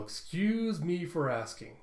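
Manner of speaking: sarcastic, slow high-pitched huff; mock offense. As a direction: act affronted, stretching it out slowly. Huffy reply to Driller's rebuff.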